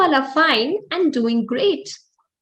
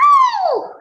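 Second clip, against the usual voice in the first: very high mouse yelp of pain; squeaky ow; 6 dB louder than usual. Acted. Mouse stepped on by an elephant, squeaky yelp.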